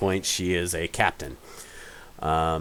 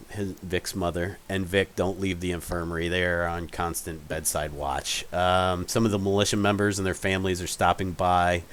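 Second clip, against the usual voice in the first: ordinary talk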